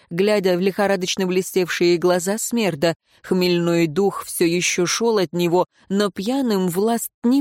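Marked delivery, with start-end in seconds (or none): none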